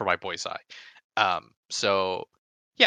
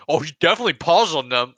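mocking accusers; 6 semitones higher, 7 dB louder than usual.

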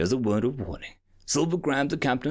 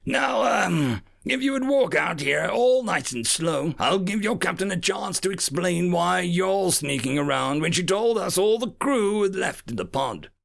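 gravelly, falsely polite menace; menacing pirate captain; 8 semitones higher, 3 dB louder than usual. A narrator's reading. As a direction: threaten with sweet malice. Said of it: "obvious malice in his voice".

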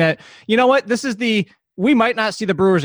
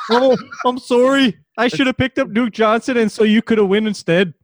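mock a meek northerner saying sorry, pitched up; apologetic northern accent; 6 semitones higher than usual. Co-host mocks apologizing North, high northern accent. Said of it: "everybody up in North apologizes".

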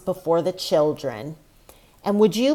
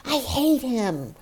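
higher, slow-drawn mock venting; mock upset outburst; 5 semitones higher than usual. Emotional woman venting about her ex, mildly upset. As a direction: act out a mildly upset vent. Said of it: "when I get upset".

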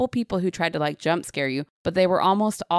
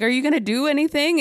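mocking taunt; 9 semitones higher, 3 dB louder than usual. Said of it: "I'm like".

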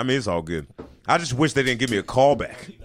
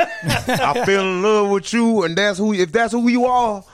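higher, slow, hammed-up pledge; mock heartfelt husband; 6 semitones higher, slower than usual. Husband vowing to stay with his partner, higher and slow. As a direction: plead your love, half laughing. Acting the husband's tearful acceptance.

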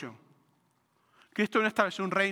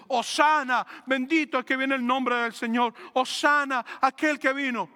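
crowd acclamation; 5 semitones higher, 4 dB louder than usual. Retold or imitated. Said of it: "la misma multitud que lo recibió diciendo".

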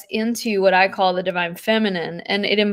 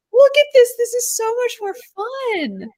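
squealing high, bright mock enthusiasm; gushing excitement. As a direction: gush like a thrilled fan. Gushing mock-quote of herself.

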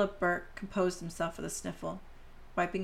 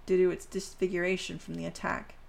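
usual speaking voice

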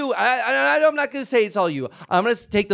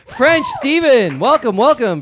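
booming host greeting, louder; loud welcome; 5 semitones higher, 6 dB louder, slower than usual. Acted. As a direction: greet him loudly like a showman. Host's loud greeting of sponsor.

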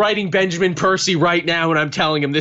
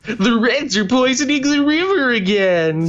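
cartoon alarm; 10 semitones higher than usual. Saturday morning cartoon parody.

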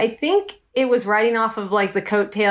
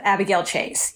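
ordinary delivery

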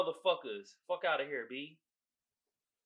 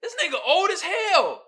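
1 laughing disbelief; 10 semitones higher, 5 dB louder than usual. Retold or imitated. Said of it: "I'm like".

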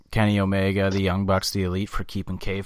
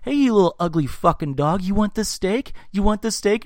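much higher, mock-sweet dog talk with insults; mean MJF taunting dog; 10 semitones higher than usual. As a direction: coo nastily at the dog, pitched up. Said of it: "He's like".

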